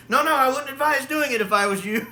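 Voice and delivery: alarmed warning. Retold or imitated.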